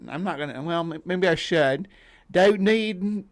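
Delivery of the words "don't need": put-on a tentative start in a New Zealand accent; Kiwi accent attempt. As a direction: try the line in a Kiwi accent, cut off. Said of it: "you gotta read that with a Kiwi accent".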